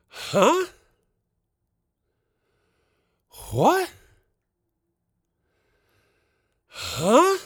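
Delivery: high, sharp bursts of confusion; startled huh. Acted. Caught in boxers at the door, startled high huh. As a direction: yelp it like you can't believe it. Startled by knock about checkout.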